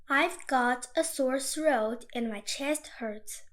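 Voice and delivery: usual speaking voice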